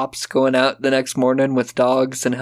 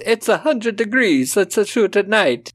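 put-on Italian accent; 7 semitones higher than usual. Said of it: "that Italian accent".

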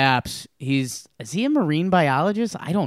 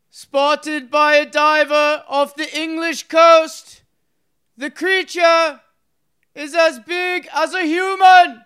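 high, slow, booming proclamation; Roman orator voice; 13 semitones higher, 8 dB louder, slower than usual. Shown as a demonstration. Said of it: "in your Roman orator voice".

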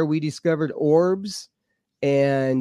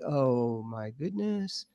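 hushed awe; 9 dB quieter, slower than usual. Retold or imitated. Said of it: "I'm like".

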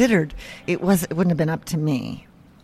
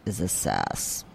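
cryptic caller voice; 11 semitones lower, 8 dB quieter, slower than usual. Retold or imitated. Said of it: "this voice says".

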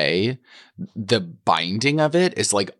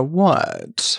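high-pitched mock "what?"; exaggerated high query, falling at the end; imitating clueless actress; 9 semitones higher than usual. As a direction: play her oblivious, pitched way up. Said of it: "She'd go".

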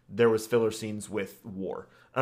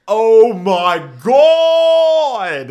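high, loud, stretched out "oh my God"; drawn-out excited cry; 10 semitones higher, 15 dB louder, much slower than usual. Real, not acted. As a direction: exclaim it slowly, amazed. Reacting to the bad intro.

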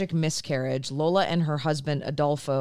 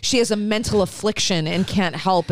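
usual speaking voice

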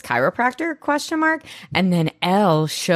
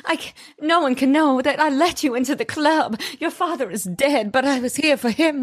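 imitating the actress; 9 semitones higher than usual. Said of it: "She's like".

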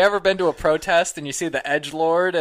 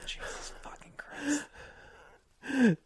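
exasperated whisper; 9 semitones higher, 7 dB quieter, much slower than usual. Real, not acted.

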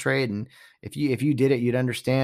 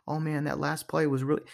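hushed, softer mock commentator; mock whisper; 8 dB quieter than usual. Commentator whispering after being told off. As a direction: hush it like a commentator caught out.

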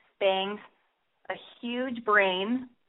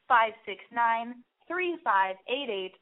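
ordinary delivery

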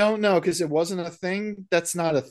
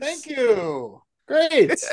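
higher, hammed-up tourist voice; a cheery tourist overacting; mock tourist; 8 semitones higher, much slower than usual.

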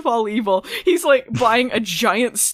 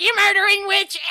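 shouting accusation; 12 semitones higher, 3 dB louder than usual. Retold or imitated. Voicing Phoebe's outburst.

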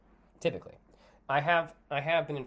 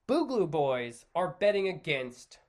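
normal talking voice

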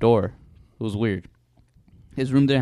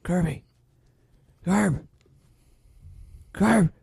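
calling, rising higher each time; building call; 8 semitones higher than usual. Retold or imitated. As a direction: call his name louder and louder. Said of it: "progressively got louder".

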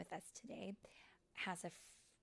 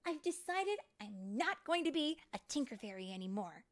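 raised, louder fairy voice; determined announcement; 6 semitones higher, 9 dB louder than usual. Acted. Fairy telling friends she quits tinkering, determined. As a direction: declare it to your friends.

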